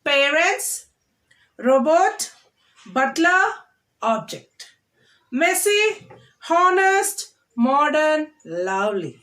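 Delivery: each word hit on its first syllable, Parents, robot, butler, object; first-syllable stress. Shown as a demonstration. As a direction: model the stress on the front of each noun. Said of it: "repeat after me".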